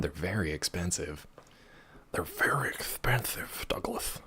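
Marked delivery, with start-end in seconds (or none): 2.1-4.2 slow secretive whisper. Acted. Repeats line then whispers it.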